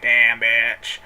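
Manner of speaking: low drawn-out damn bitch; fed up and sassy at the bank; sassy curse. Retold or imitated. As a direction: put real attitude on it. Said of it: "I was like, pissed".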